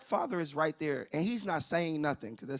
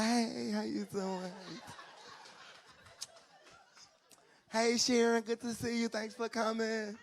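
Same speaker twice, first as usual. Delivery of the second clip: mom greeting guests; 7 semitones higher, 4 dB quieter than usual. Retold or imitated.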